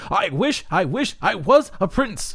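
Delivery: high, envious stonecutter voice; jealous stonecutter. Acted. The stonecutter envies the passing prince, high and jealous. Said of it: "said the stonecutter jealously".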